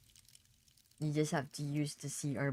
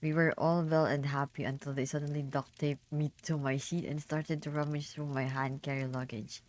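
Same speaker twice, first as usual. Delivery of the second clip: ordinary delivery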